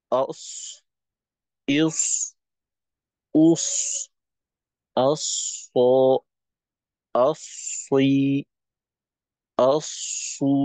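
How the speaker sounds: heavy held অস, ইস, উস with airflow running; ṣad with sukun. Shown as a demonstration. Teacher showing students the ṣad with sukun. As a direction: sound অস, অসই, অসু thick with steady air. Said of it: "জজম দিয়ে".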